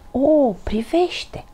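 very slow, high wonder; amazed exclamation. A narrator's reading. Albert spots the wishing wand, amazed. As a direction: draw it out in awe. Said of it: "exclamă Albert".

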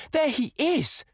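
very high, loud exclamation; excited cry. A narrator's reading. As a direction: cry out at sighting your beloved. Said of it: "she cried".

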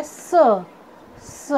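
स as a lone hissed "s" with little vowel; phonic letter sound. Shown as a demonstration. Teacher showing students the sound of S, phonic letter sound. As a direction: sound out स as S starts "sun". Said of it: "एस का sound होता है".

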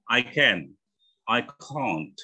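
British can't with a long ah; British accent. Shown as a demonstration. Teacher contrasts can and can't, British accent. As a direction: say can't as "cahnt", British style. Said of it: "Enggaknya gitu kalau British".

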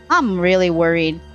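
ordinary delivery

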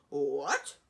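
loud startled what. A narrator's reading. Dragon hearing a wizard came near, loud startled what. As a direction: bark a surprised what.

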